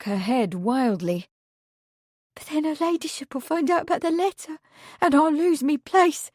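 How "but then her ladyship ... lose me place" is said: high, fast, frightened rush of words; panicked gabble. A narrator's reading. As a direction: blurt it out in panic. Meg panics about the letter.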